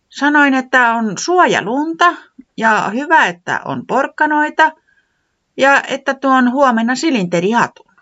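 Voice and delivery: high, loud little voice; Tiputi retelling. Acted. Tiputi repeating her hints to Pöllis, high and loud. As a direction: list the hints eagerly.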